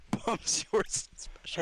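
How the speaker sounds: barely holding in laughter; stifled giggles. Real, not acted. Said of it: "We do not need to hear your breathing".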